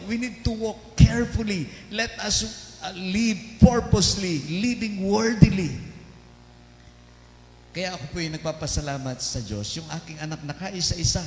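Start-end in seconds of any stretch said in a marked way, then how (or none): 0.1-5.9 preaching emphasis. Acted. Preaching exhortation.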